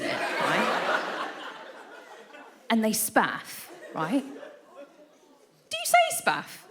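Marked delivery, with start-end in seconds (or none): none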